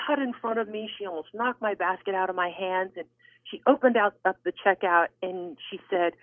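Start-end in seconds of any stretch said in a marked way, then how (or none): none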